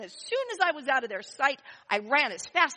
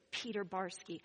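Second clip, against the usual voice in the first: normal talking voice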